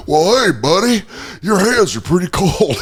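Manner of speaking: high, creepy hammed-up vagrant; mock creepy bum voice; homeless guy voice. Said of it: "our homeless jerk-off guy voice".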